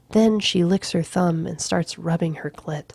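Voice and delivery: plain reading voice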